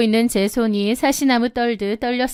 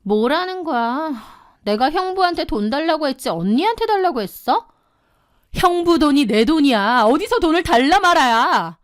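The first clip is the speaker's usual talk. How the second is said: higher, sneering retort; scornful sister; 5 semitones higher than usual. Acted. Younger sister answering her sister's scolding, scornful and brazen. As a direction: scoff back in a higher, brazen voice. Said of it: "코웃음을 치며 기막힌 소리를".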